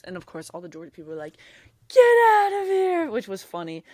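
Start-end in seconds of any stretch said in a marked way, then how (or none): 1.9-3.1 high, loud, drawn-out imitated jeer; crowd jeer. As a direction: holler it long like a hostile crowd. Imitates crowd jeering an ejected fan.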